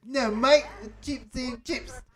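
high, hammed-up British correction; mock British accent. Joking Brit correcting potato chips, mock British accent. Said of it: "the heavy British accent".

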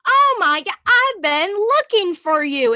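high, loud and slow, gushing with delight; excited greeting. Retold or imitated. Bia hugging a stranger, thrilled to see her, high and slow. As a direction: gush like you've finally found her. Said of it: "Literally, Bia goes".

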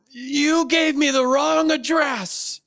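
high, drawn-out mock outrage; hammed-up screechy complaint; imitated yelling tenant. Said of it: "she's yelling at me on the phone".

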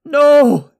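high, loud cry of desperation; pleading. A narrator's reading. Mother begging the archers not to fire, pleading. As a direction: cry out in panic to stop them. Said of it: "Talgo's mother pleaded".

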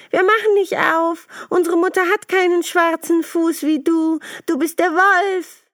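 little goats shouting no through the door; defiant goat kids. Acted. Kids refusing to open for the wolf, high and loud.

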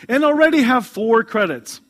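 proud boast. Acted. Mother's proud reply.

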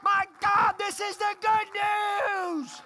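loud, high, fervent preacher shout; preaching at full force. Acted. Pastor shouting the Spirit's warning to the congregation, crying out.

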